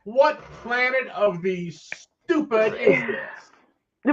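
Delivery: slow, high put-on indignant voice; hammed-up outrage at the stupidity; mock indignant. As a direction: drag it out in disbelief.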